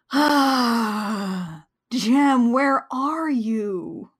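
mock lovesick sigh, slowed plea; lightly hammed-up pining; dreamy Ryo. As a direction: swoon over the missing singer. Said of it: "and he's like".

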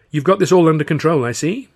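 sarcastic jab at a helpless colleague; tart remark. Acted. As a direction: needle him with dry sarcasm. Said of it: "she said, tartly".

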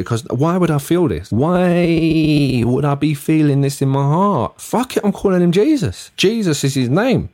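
high, swelling emotional declaration; acted-out swept-away dad; overwhelmed new father. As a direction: build from stretched-out wonder to high-pitched decision. Acts overwhelmed new father naming son.

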